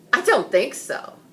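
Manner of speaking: a lightly voiced king turning down his son; king's dismissal. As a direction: brush off the son's idea.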